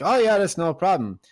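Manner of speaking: cheery agreement. Retold or imitated. Paddy agreeing to climb, high and loud. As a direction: toss it off brightly up high.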